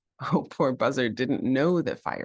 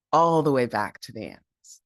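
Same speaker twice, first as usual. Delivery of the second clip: plain reading voice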